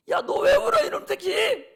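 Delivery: high, loud parental yell; hammed-up outburst at a crying baby; mock angry scolding. (우는 아기한테 버럭 야단치듯)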